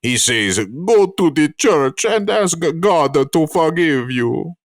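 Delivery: put-on slow storytelling in a Quebecois accent; heavy French Canadian accent, drawn out. Reciting the hockey sweater story to the co-hosts, Quebecois accent. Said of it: "all the Quebecois people".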